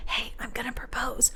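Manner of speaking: hushed whisper; whispered aside. Retold or imitated. Groom who never warned anyone, whispered. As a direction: whisper it like a secret. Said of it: "He didn't say".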